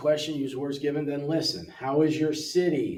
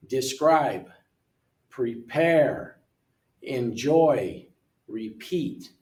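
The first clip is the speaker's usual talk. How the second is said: deSCRIBE, prePARE, enJOY, rePEAT, second syllable louder and longer; second-syllable stress; much slower than usual. Shown as a demonstration. Teacher shows learners verb stress, second syllables of describe, prepare, enjoy, repeat stressed. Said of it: "the second syllable is louder and longer".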